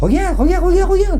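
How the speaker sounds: high loud excited calling. Real, not acted. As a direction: call them over, thrilled. Rods moving again, calling out.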